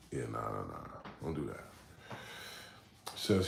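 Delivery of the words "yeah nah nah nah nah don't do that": much lower, softer put-on voice; deep mock dismissal. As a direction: drop the voice way down and wave it off.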